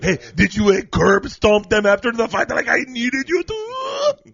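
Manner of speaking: very high, loud, slow shouting vampire; goofy vampire yelling orders; Adam Sandler vampire. Pretend. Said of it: "Adam Sandler vampire".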